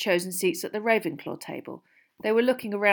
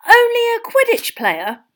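high, loud, slow disbelief; scandalised fan, voice soaring up; incredulous echo; 12 dB louder than usual. A narrator's reading. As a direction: throw it back as an outraged question. Ron incredulously echoes Hermione.

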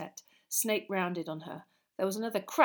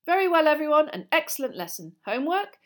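loud, raised classroom voice; brisk projected sign-off; teacher addressing class; 8 semitones higher, 9 dB louder than usual. A narrator's reading. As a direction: call the class to order to wrap up. Teacher wraps up the lesson.

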